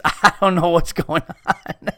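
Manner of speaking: talking through laughter; laughing. Real, not acted. Cracking up at his own story, laughing. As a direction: laugh while getting the words out.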